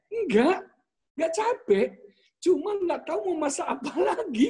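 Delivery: high-pitched imitation of tearful whining; mock crying. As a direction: mimic a tearful complaint, laughter creeping in. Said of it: "Dia nangis". (pura-pura merengek sambil menangis)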